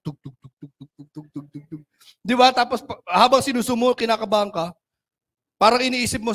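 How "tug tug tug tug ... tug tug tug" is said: soft, low imitated heartbeat thumps; heartbeat sound. Agent waits for the receipt, mimicking a nervous heartbeat. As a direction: tap out a nervous heartbeat under your breath. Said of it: "nakakaramdam ka ng kaba".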